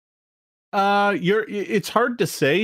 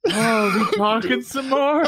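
airy dancer; 5 semitones higher than usual. Acted. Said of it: "she's like".

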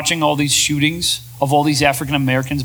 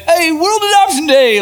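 high, loud, slow sing-song celebration; hammed-up party announcement; mock cheer; 12 semitones higher, 5 dB louder than usual. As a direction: cheer it brightly while the world melts down. Mock cheer amid election panic.

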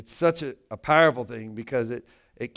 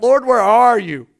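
crying out; 9 semitones higher, 10 dB louder than usual. Retold or imitated. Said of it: "I've cried out".

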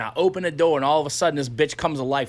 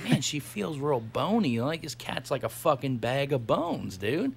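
quiet worry; 3 semitones lower, 10 dB quieter than usual. Retold or imitated. Worried remark about bony cat.

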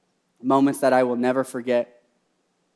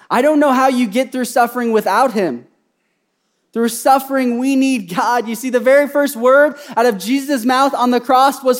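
loud, high, impassioned sermon; fervent preacher building to a cry; full-force preaching; 11 semitones higher, 9 dB louder than usual. Real, not acted. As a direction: drive it home to the congregation.

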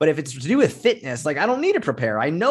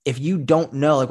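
usual speaking voice

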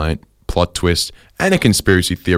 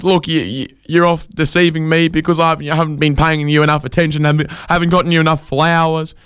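pleading husband; 9 semitones higher than usual. Retold or imitated.

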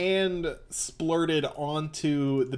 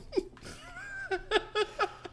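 high burst of laughter; 5 dB quieter than usual. Real, not acted.

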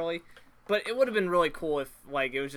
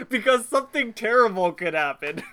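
laughing; 4 semitones higher, 6 dB louder than usual. Real, not acted. Laughing at the plot.

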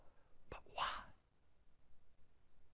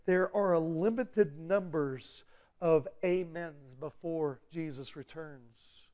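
normal talking voice

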